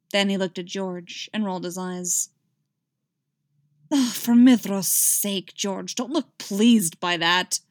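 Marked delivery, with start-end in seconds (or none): 3.9-7.6 slow, louder, fed-up groan of words; exasperated. Acted. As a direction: drag it out in disbelief. Exasperated king scolds George.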